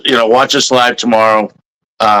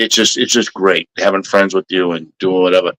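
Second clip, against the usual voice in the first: ordinary delivery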